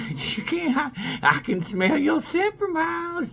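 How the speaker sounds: high, slow, hushed menace; creepy hunter. Retold or imitated. Warning he can smell her for miles, slow high menace. Continues predator speech.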